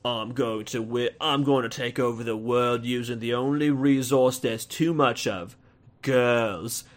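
mock movie villain with a put-on accent; Dreykov's villain accent. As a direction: imitate a badly accented villain announcing his plan. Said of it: "Ray Winston's character Dreykov says something like".

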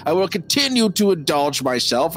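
a light attempt at an Irish brogue; lightly voiced drunk Irishman; McDermott's Irish voice. Fan trying McDermott's Irish voice for his friend. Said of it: "let me say that again".

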